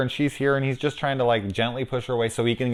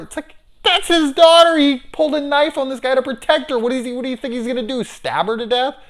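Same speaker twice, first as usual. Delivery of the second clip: high, loud, racing rant; exasperated rant; 7 dB louder, faster than usual. Real, not acted. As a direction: rant at the screen in disbelief. Ranting outrage at scene.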